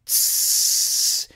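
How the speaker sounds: long imitated fizzing hiss; sustained sibilant hiss; bottle hiss. A narrator's reading. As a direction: hiss like a bottle being opened. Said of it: "went the five and twenty bottled ales".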